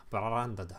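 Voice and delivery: BRANDED with a trilled R after the B; "Branded" in mock Japanese with an exaggerated rolled R. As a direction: roll your tongue on the R in "Branded". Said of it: "I know I'm rolling my tongue".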